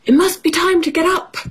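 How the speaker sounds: high, quick dog voice; eager little dog in a children's story; storybook dog. Acted. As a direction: pitch it up and hurry it along. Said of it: "said dog".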